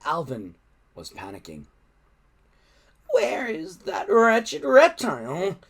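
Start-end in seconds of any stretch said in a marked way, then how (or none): 3.1-5.6 high, loud and slow panicked snarl; hissed. Acted. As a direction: spit out the question in panic. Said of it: "he hissed".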